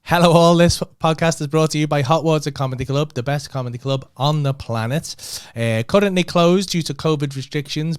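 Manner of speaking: loud upbeat ad read. Shown as a demonstration. Podcast host reads sponsor ad, loud and upbeat. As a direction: sell it like a radio sponsor spot. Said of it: "I'll play it".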